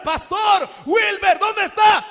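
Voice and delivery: high, loud panicky calling out; frantic shouting. Retold or imitated. Believer in trouble calling the pastor, frantic shout. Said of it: "No comiences a gritar".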